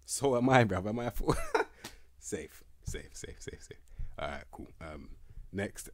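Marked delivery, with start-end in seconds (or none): 1.4-1.9 very high squeal of a laugh; squeaky laugh. Real, not acted. Vlogger laughing at calling himself a fool, squeaky laugh. 2.2-3.8 whispered repeats. Real, not acted. Vlogger wrapping up a player review, whispering.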